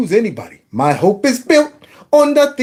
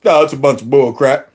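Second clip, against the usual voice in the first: imitating the brother; 8 semitones lower than usual. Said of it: "hear that brother out there talking about".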